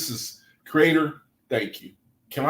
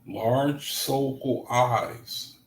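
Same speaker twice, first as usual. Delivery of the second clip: slow, dreamy reading; 3 dB quieter, much slower than usual. Shown as a demonstration. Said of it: "What is a large, soulful eye?".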